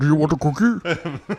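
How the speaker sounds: Andre the Giant impression.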